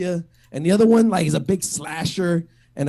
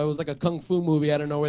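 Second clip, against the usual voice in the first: usual speaking voice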